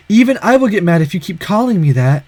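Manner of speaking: ordinary delivery, nothing marked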